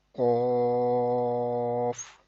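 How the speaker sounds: قاف with a heavy rounded long vowel; thick, full qaaf. Shown as a demonstration. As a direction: hold قاف full-mouthed, then close on f. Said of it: "موٹا پڑھیں گے". (قاف موٹا اور لمبا)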